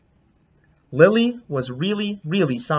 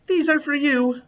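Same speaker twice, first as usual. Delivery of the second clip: shy gift offer; 10 semitones higher than usual. Acted. Lily giving snacks.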